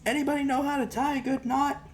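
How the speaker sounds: very high, exaggerated question to the air; a hammed-up loud plea for help; talking loud to myself. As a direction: sing out the question high for anyone listening. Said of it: "talking loud to myself".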